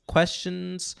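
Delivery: ordinary delivery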